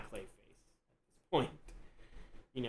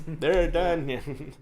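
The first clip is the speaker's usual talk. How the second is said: laughing; 4 semitones higher, 9 dB louder than usual. Real, not acted. Laughing at the quick sculpt joke.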